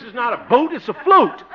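high, insistent contrast on boat and float; exasperated correction. Acted. Exasperated applicant corrects clerk.

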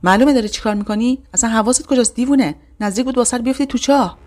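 loud, high shout of shock. Acted. Girl yells at her sister who nearly fell, loud shout. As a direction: yell at her, scared and furious. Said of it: "داد زدم".

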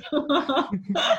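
real laugh, burst out; hearty laugh. Real, not acted. Guest laughing after insisting moms are right, hearty laugh. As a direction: let a real laugh break out.

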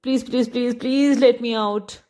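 high wail, last please stretched out; wailing begging. A narrator's reading. Genie pleading from the bottle, wailing. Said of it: "wailed the genie".

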